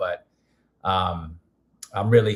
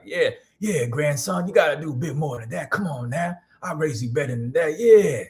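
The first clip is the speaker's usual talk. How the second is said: put-on higher elder voice with an old-school twang; coaxing grandpa impression, rising on the last word; grandfather's old-time accent; 4 semitones higher than usual. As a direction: imitate granddad's Louisiana twang, pitched up. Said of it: "that's how he would talk".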